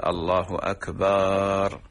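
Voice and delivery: أكبر slowly drawn out as "akbaaar"; stretched ba. Shown as a demonstration. Sheikh imitating muezzins' mistake in Allahu akbar, stretched ba. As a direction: hold a long aa after the b in أكبر. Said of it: "مد الباء في أكبر".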